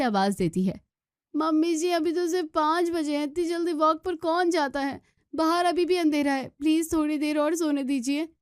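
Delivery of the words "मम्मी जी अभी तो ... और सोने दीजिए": put-on much higher, pleading young wife; pleading daughter-in-law. Daughter-in-law pleads from bed with mother-in-law, high and pleading. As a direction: beg for a little more sleep.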